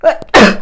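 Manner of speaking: a sudden loud cough into the mic; sharp real cough mid-sentence. Real, not acted. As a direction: cough hard, as if the allergies just caught you. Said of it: "Je vais atchoumer".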